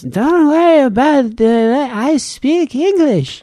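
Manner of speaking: put-on high, slow, drawn-out English with a thick foreign accent; accented X-ray guy. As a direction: imitate his laboured accented English, stretching every word. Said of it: "exactly how he sounds".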